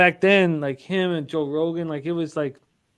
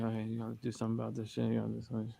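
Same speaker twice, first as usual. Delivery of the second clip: hushed urgent plea; 6 semitones lower, 15 dB quieter, much faster than usual. Retold or imitated. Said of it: "they're all like".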